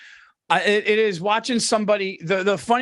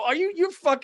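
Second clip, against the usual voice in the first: usual speaking voice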